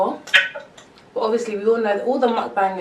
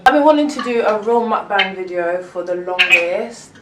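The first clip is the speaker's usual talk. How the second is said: usual speaking voice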